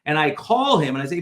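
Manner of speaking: ordinary delivery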